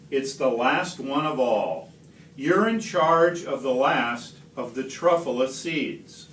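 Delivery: ordinary delivery, nothing marked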